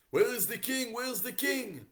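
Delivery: high, loud urgent call; frantic messenger. Acted. Acted frantic messenger in story.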